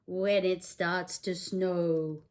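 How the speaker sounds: lively picture-book read-aloud; singsong bedtime book voice; reading to children. Acted. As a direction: read it like storytime for a little one.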